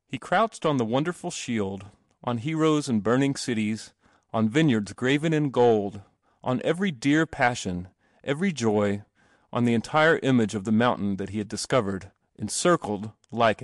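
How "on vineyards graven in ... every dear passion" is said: plain reading voice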